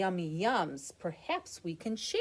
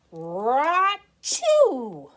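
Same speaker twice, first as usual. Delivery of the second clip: loud, high hammed-up achoo; big acted sneeze; 7 semitones higher, 13 dB louder than usual. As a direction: sneeze it out like a bear. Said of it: "He blows and he sneezes".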